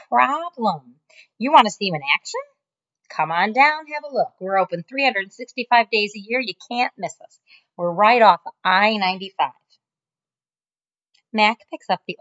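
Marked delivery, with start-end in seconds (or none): 0.1-9.5 fast, higher salesman patter; salesy pitch. Acted. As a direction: sell it like a pitchman. Mac selling to caller.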